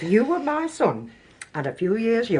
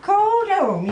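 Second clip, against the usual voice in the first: surprised; 4 dB louder than usual. Acted. Innkeeper surprised by the name.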